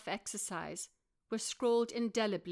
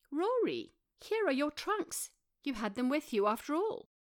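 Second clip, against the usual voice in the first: higher accusing discovery; surprised Claire; 6 semitones higher than usual. A narrator's reading. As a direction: catch him out, higher. Claire finds the trunks.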